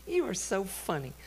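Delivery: put-on high, light little-girl voice; imitated daughter, unimpressed and high; daughter's teasing scoff. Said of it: "She went".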